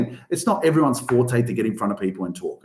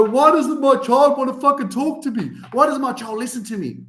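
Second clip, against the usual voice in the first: much higher, complaining parent voice; exasperated parent. Retold or imitated. Parent complaining about a child who won't talk, whiny. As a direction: whine it like a fed-up parent. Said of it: "So then you go".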